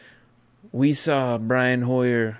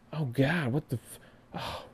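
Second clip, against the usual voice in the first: ordinary delivery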